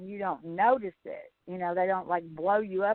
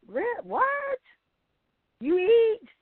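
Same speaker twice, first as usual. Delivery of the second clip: incredulous; 12 semitones higher, 4 dB louder, slower than usual. Real, not acted. Incredulous reaction.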